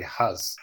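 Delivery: "hus" with a short central Kiwi vowel for "his"; New Zealand "his". Shown as a demonstration. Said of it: "instead of saying his".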